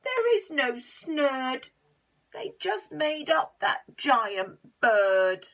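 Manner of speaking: slow, loud grumbling complaint; complaining. Acted. Len complaining there is no snurd, slow loud grumble. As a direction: drag out the grievance. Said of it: "And Len complained".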